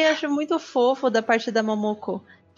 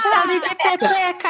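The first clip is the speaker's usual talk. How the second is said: joking shout; 7 semitones higher than usual. Acted.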